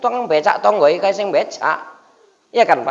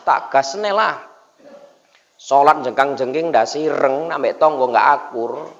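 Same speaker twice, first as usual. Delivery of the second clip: usual speaking voice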